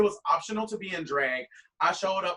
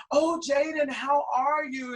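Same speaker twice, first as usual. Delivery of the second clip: higher, slow sing-song greeting; 7 semitones higher, 4 dB louder, slower than usual. Retold or imitated. Queen voices [name] greeting him at party, higher.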